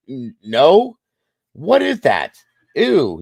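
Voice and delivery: a lightly acted little boy going eww, pushing the meat away; retold kid disgust. Kid spits out possum meat.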